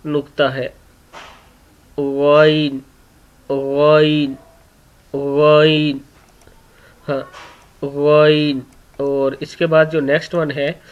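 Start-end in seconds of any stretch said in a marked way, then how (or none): 2.0-6.0 वाइन from the upper throat, toward the mouth; Arabic غ demo; throat letter demo. Shown as a demonstration. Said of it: "खलक के ऊपर वाले से ... अदा होता है वाइन". 7.8-8.6 वाइन from the upper throat, toward the mouth; Arabic غ demo; throat letter demo. Shown as a demonstration. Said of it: "अदा होता है वाइन".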